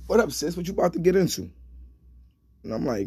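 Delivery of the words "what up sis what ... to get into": higher, louder retold greeting; imitating a friend's lively hello. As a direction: call out like a buddy checking in.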